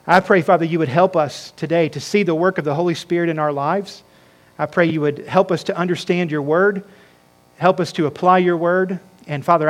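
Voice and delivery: low, softened, even prayer voice; public prayer. Real, not acted. Pastor praying over his congregation, quiet prayer. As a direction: lead them humbly in prayer.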